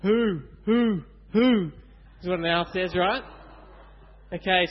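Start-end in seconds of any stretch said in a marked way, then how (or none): 0.0-1.7 loud hooting owl call; playful owl hoots. Shown as a demonstration. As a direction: hoo like an owl for the kids. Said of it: "what sound does an owl make".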